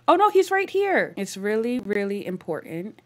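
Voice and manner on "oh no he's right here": surprised. Real, not acted. Guest suddenly appears in game.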